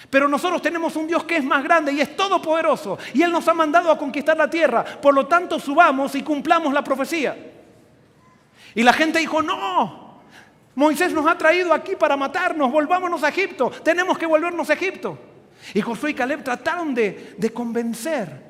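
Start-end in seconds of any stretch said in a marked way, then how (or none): 0.1-7.4 raised, higher voice urging the crowd on; rally a frightened people with conviction; rousing exhortation. Acted. Acted rallying speech of Joshua, Caleb. 9.4-15.2 raised, high-pitched crowd protest; acted angry refusal.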